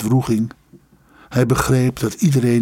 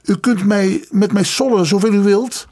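high-pitched, heated retort; fiery defiance; 9 semitones higher, 3 dB louder than usual. A narrator's reading. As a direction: stand up to him, passionately protective. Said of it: "vervolgde de student met fonkelende ogen". (fel en strijdlustig)

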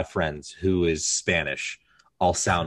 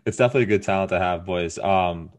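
ordinary delivery

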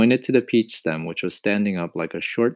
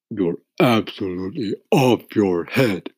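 earthworm voice; 2 semitones higher, 5 dB louder than usual. A narrator's reading. Earthworm protests.

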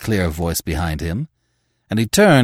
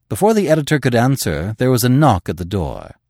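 usual speaking voice

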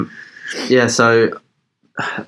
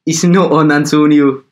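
mock outraged outburst; 5 semitones higher, 6 dB louder than usual. Ribbing Antonio as a radio joke, mock outraged. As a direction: play up the complaint, louder and higher.

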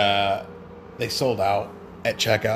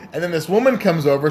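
ordinary delivery

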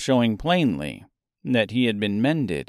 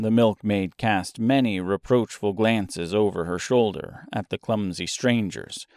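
ordinary delivery, nothing marked

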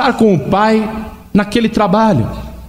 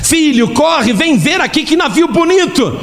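chamando o filho; 10 semitones higher than usual. Acted.

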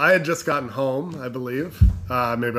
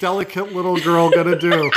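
pitying coo; 6 semitones higher than usual. Retold or imitated. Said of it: "everyone was like".

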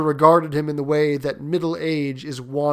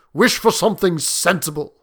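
louder, stern advice; gruff; 4 semitones higher, 6 dB louder than usual. Acted. Soldier urging his host to be sensible, gruffly. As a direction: finish the warning gruffly, voice raised. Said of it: "he said gruffly".

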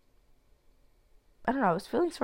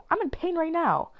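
put-on higher, whiny inner-child voice; mock childish complaint; 10 semitones higher than usual. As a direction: whine it like a hurt kid. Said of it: "so I'm like".

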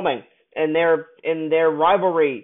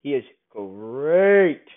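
normal talking voice

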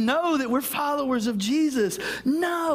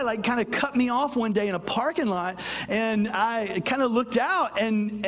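ordinary talk, nothing marked

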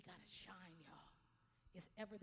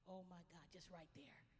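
whisper; 5 semitones lower, 8 dB quieter than usual. Real, not acted. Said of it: "whispered aside".

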